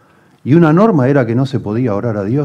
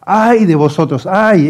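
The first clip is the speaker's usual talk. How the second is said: high, loud wailing woe; a lamenting cry, raised high and loud; grieving lament; 8 semitones higher, 6 dB louder, much slower than usual. Retold or imitated. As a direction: cry out the woes as if heartbroken. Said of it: "Es que da ganas de llorar".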